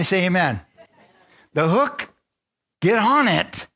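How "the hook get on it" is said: high, loud order; God's command. Acted. The Lord orders the fish onto the hook, high and loud.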